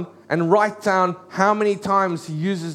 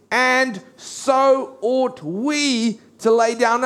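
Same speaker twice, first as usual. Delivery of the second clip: higher, drawn-out words at half speed; slow, emphatic quotation; 5 semitones higher, much slower than usual. Retold or imitated. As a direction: stretch the verse out with weight. Preacher quotes next verse with weight.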